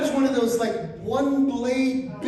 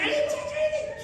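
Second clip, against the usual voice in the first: very high defensive protest; comic high-pitched innocence; squeaky denial. Retold or imitated. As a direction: squeal it out in panic. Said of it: "I'm like".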